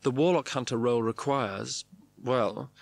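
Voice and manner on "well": ordinary delivery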